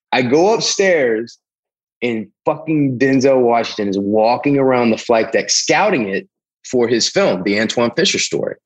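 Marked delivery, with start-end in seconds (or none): none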